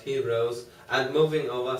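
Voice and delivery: plain reading voice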